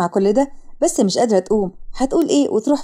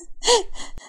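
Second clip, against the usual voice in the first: soft, high acted sob; breathy little crying sound; sobbing; 9 semitones higher, 6 dB quieter than usual. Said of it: "وسارة ببكاء". (شهقة عياط خافتة)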